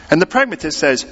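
ordinary delivery

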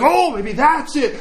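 high, slow hopeful wonder; mock awed realization. Disciple hears the house settle, mock hopeful wonder. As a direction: play a slowly dawning, overeager discovery.

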